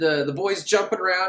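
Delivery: ordinary delivery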